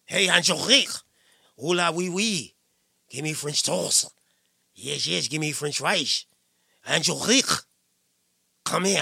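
low, throaty French caricature with rising phrase ends; hammed-up French flirt, pitched low; mock French accent. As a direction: ham up a French accent, rolling guttural Rs. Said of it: "it's a French pronunciation".

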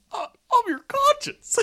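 high-pitched squeaky cricket character; Ethical Bug. Acted. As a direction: squeal it in a tiny cartoon bug voice. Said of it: "fucked up Jiminy Cricket from Puss in Boots".

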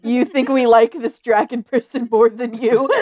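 loud, laughing disbelief; laughing loud retort. Acted. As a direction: blurt it out laughing. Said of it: "I look at her just like".

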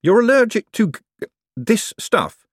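high question, caught just in time; hasty self-correction. Acted. Moist avoiding the chef's trigger word, correcting himself. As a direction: stop short on the forbidden word, then swerve. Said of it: "correcting himself just in time".

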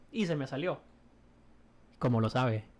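usual speaking voice

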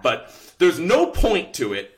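normal talking voice

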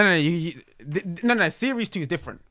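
ordinary talk

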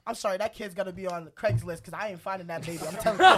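ordinary talk, nothing marked

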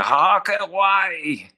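slow, high delighted "Ah, qué guay"; drawn-out excited wow. Retold or imitated. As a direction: stretch out the "guay" in awe. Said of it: "decía".

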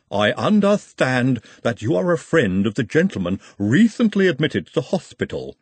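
measured, formal Igor voice with a foreign lilt. Acted. Igor questions Trev in the fog.

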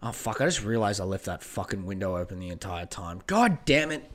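ordinary delivery

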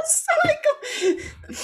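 high-pitched giggle running into the words, slowed down; laughing through it at her own story. Real, not acted. Laughing at her drunk self.

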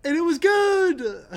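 slow, rising mock sermon; mock preacher. Joking about Idris Elba, a slow, rising mock-preacher voice.

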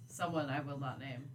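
ordinary talk

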